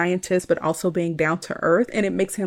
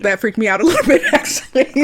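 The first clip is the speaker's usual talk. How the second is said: laughing; 4 semitones higher, 6 dB louder than usual. Real, not acted. Laughing while admitting fright.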